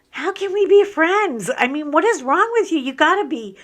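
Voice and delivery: loud, high-pitched incredulous challenge; mock indignation. Real, not acted. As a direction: raise your voice in mock disbelief. Challenges viewers who wouldn't cry.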